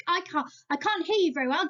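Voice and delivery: ordinary talk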